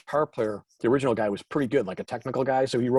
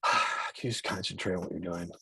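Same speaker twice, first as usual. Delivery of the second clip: sighing, low, quiet exasperation; sigh, then plead under your breath; weary, hushed reproach; exasperated plea; 6 semitones lower, 9 dB quieter than usual. Retold or imitated. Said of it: "now I'm a jerk".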